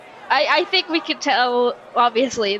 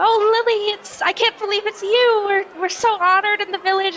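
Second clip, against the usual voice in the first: high, excited congratulations; brave-faced delight; 9 semitones higher, 3 dB louder than usual. Acted. Caretaker reminding Lily what an honor it is, bravely delighted. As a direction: gush proudly to hide the nerves. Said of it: "put on a brave face".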